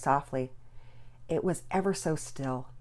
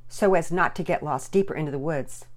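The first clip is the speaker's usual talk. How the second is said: ordinary delivery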